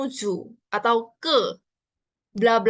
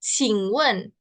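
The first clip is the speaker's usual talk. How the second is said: slow demo; 5 dB louder, much slower than usual. Shown as a demonstration. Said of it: "gimana cara bacanya?".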